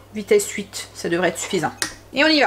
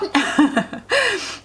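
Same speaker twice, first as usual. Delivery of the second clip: short laugh; 2 semitones higher than usual. Real, not acted. Laughs at own avoided word.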